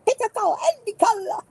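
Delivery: high showman patter; magician voice. Pretend. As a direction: play a stage magician.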